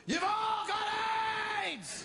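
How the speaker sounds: high, wild shouted provocation; mock shout. Buddy urging him on air, high mock shout. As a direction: yell it at the camera like a madman. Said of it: "and you go".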